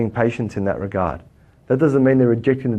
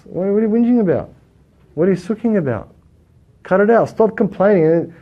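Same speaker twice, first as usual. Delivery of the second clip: scolding; 6 semitones higher than usual. Real, not acted. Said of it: "scolding the audience".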